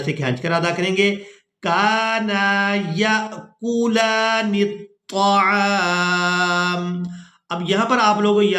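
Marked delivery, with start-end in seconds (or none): 1.6-7.0 slow melodic tajweed recitation; Quran recitation. Shown as a demonstration. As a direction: chant it slowly, stretching the long vowels.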